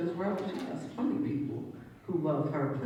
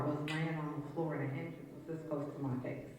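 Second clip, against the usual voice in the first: ordinary delivery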